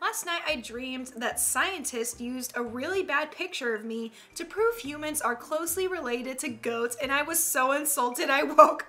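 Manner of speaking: usual speaking voice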